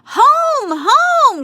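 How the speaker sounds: very high, loud urgent shout. A narrator's reading. Boys shouting at Amelia Bedelia to run home, high and loud. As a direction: call her home at full voice. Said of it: "shouted the boys".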